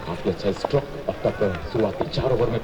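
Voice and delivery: ordinary talk, nothing marked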